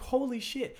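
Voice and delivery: high, stunned outburst of disbelief; retold amazed exclamation. Telling his podcast cohost how he reacted, a high amazed exclamation. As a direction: replay the moment it clicked, jaw dropping.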